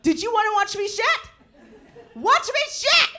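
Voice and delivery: high, loud, defiant "watch me shit"; indignant dog. Retold or imitated. The pooping dog glaring back at her, high defiant taunt. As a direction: challenge her as an offended dog. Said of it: "the dog looked back at me like".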